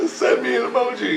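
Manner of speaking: high, amused jeer; mocking comeback. Man retelling his comeback to the voice, mocking. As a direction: repeat the retort high, tickled by it.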